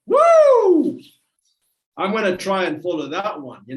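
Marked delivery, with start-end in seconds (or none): 0.1-1.0 loud, very high "woo"; piercing whoop of mock amazement; excited whoop. Real, not acted. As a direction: let out a big whooping cheer. Jokingly praising the clip just played.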